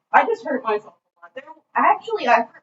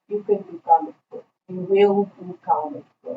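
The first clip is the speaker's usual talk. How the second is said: put-on Armin's German accent; 4 semitones lower than usual. German professor urges student into comics, thick accent. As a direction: pronounce it with a heavy German accent. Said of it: "I just get a German accent".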